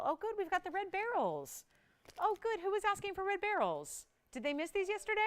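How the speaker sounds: light up like good news just came in; delighted. Real, not acted. Delighted the red barrels arrived.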